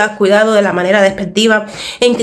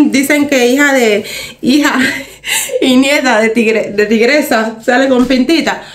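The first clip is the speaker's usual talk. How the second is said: laughing; 2 semitones higher than usual. Real, not acted. Quotes a saying, laughing.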